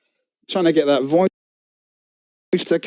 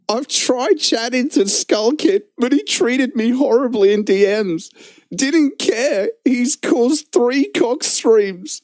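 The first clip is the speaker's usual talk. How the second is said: high, slow, whiny mock voice of the rival; mocking the rival streamer; 7 semitones higher than usual. Streamer reading a rival's post aloud in his voice, mock whiny and high. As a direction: read his post as a pitiful wounded whine. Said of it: "I'm gonna try and do like him".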